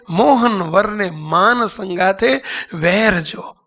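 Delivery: slow, measured recitation without singing; spoken verse. Real, not acted. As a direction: say the verse slowly, word by word. Same verse spoken while explaining. (ધીમેથી બોલાયેલી પંક્તિ)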